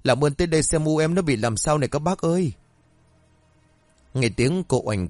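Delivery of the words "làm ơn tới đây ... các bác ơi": high, pleading sobbing cry for help; wailing cry. Acted. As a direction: cry out over a collapsed mother, begging neighbours. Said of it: "ôm mẹ rồi gào khóc". (gào khóc thảm thiết, giọng cao, gấp gáp cầu cứu)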